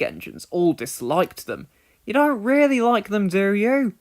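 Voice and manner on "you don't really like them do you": high, loud amazed question. Acted. James asking Douglas, amazed. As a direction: sound truly astonished at the twins. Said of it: "asked in amazement".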